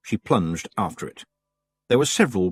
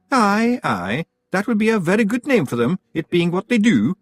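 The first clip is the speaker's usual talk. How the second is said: very high Scots pixie voice; tiny Scottish chieftain; Rob Anybody; 10 semitones higher, 4 dB louder than usual. A narrator's reading.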